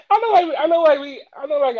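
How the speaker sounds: high, loud, giggly protest; laughing. Real, not acted. Laughing with his friend, high and loud. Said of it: "laughing after the joke".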